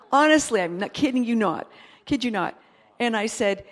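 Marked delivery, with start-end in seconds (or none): none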